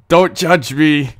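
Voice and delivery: usual speaking voice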